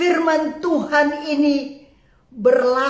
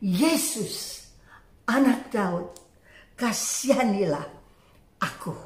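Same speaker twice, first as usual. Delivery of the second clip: low, soft, halting cry for mercy; hushed retold plea, fading at the end; subdued plea; 6 semitones lower, 6 dB quieter than usual. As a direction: plead quietly, pausing between phrases. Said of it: "Dan Dia mulai berseru".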